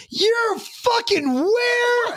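very high, slow, stretched-out yell; mock furious dad shout. Father learning his son is in jail, slow stretched shout. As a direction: scream it in disbelief, dragging every word.

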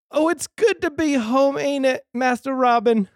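hammed-up sidekick greeting Robin; loyal servant sidekick voice. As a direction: chirp it like a jolly manservant welcoming his master home. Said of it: "That was really good".

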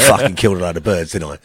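low cockney cat voice; low-pitched, matey cockney moggy. Acted. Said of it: "all cats are cockneys".

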